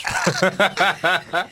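slow laughing admission. Real, not acted. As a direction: laugh through the words. (en riant)

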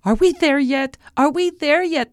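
put-on high, impatient child; high-pitched excited little girl; eager princess. A narrator's reading. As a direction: pester eagerly like a kid on a journey. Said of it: "the princess asked eagerly".

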